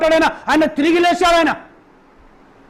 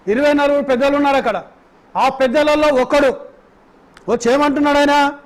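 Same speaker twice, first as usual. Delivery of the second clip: usual speaking voice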